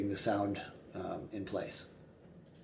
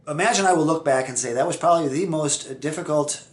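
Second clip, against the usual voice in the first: usual speaking voice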